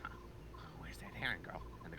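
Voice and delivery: hushed sinister whisper. Acted. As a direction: whisper like a scheming villain. Whispered villain lines.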